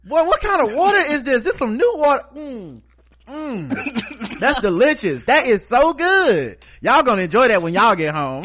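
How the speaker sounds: high, loud savoring clerk; gushing cashier voice. Retold or imitated. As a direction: savor and gush over groceries. Grocer gushing over the water.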